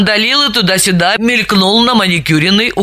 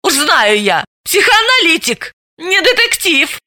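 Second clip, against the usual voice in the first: scornful snort; 10 semitones higher than usual. A narrator's reading. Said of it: "раздражённо фыркнула".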